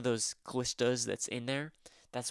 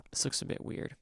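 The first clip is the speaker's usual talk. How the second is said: muttering; 4 dB quieter than usual. Real, not acted. Muttering to himself at computer.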